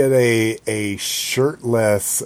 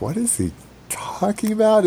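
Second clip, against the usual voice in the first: confused; 11 semitones higher than usual. Retold or imitated. Confused thought, retold.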